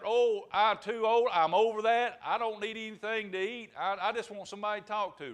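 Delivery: storyteller's wheedling wolf; pleading old wolf. Acted. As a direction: plead harmlessness to lure them in. Wolf keeps coaxing the rabbit.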